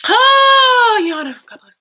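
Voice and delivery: loud, high, teary cry of thanks; real tears bursting out at a donation; overcome, crying out loud with gratitude; overwhelmed crying out. Real, not acted. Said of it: "I'm just, like, overwhelmed".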